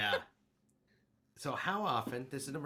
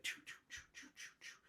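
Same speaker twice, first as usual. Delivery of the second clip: breathy train noise; whispered choo choo; 13 dB quieter than usual. Retold or imitated. Imitating scattered mind sound.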